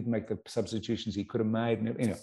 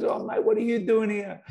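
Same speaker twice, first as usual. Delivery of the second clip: Viduka's surprised greeting; 10 semitones higher than usual. Retold or imitated. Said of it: "He goes".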